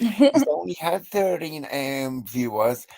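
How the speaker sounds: quoting the rival in a put-on American accent; mock American accent. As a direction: imitate her in a bad American accent. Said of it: "an American accent".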